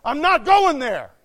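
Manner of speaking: loud, high, emphatic revolt; impassioned shouted resolve; defiant refusal. Shown as a demonstration. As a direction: declare it forcefully, refusing to budge. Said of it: "You should say".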